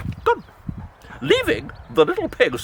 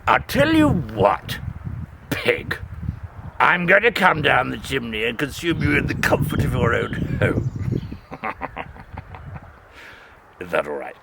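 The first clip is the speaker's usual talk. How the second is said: low wolf voice; 12 semitones lower than usual. Acted. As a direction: menace dressed as politeness, low. Wolf threatening pig.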